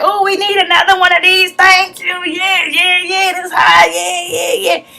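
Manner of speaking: mock giddy woman squealing over a present; excited squeal. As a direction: gush like you just got the best gift. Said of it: "She's all excited".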